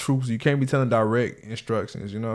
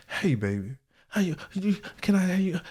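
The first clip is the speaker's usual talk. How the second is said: sweet-talking voice; 7 semitones higher, 5 dB quieter than usual. Retold or imitated. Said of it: "The voice change".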